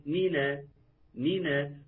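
مينا joined with an open a on the ن; nun with fatha. Shown as a demonstration. Teacher demonstrates the fatha linking in مينا. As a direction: say مينا, opening the ن to na. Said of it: "إيه اللي حصل لما قلت مينا؟".

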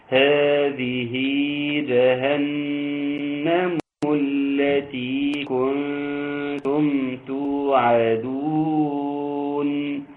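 slow, melodic tajweed chant; teacher chanting the verse with full elongations; Quranic recitation. Acted. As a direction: draw each vowel out in a measured chant.

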